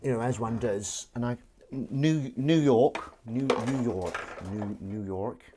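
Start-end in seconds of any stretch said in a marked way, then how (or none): 1.7-5.4 trying out an American New York; halting, uncertain go at an American accent; attempted American accent. Shown as a demonstration. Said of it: "difficult to do an American accent".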